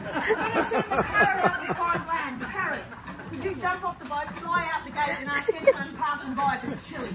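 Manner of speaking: loud, projected theatrical captain, rallying the crew; boisterous captain cheering on his crew; stage convict captain. Acted. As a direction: play it big for the back row, a jolly ship's captain. Actor playing convict captain on stage.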